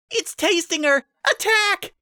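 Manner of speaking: high, loud alarmed scream. A narrator's reading. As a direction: yell the alarm. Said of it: "Pinkie screamed".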